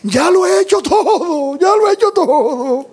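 hammed-up wailing, very high and drawn out; desperate crying. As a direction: imitate a desperate woman sobbing to her pastor. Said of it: "desesperada, llorando, me dice". (llanto desesperado, imitado con exageración)

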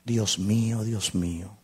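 lightly acted plea, fading to a whisper; forsaken Jesus. Believer feeling like Jesus forsaken, plea sinking into a whisper. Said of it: "usted se siente como Jesús".

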